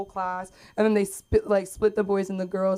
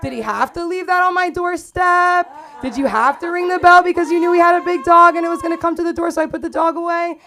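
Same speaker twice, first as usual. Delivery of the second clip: high, loud comic outrage; indignant rant; 9 semitones higher, 10 dB louder than usual. Acted. Woman rants about the ex who left a painting, indignant. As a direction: rail at an ex in rising disbelief.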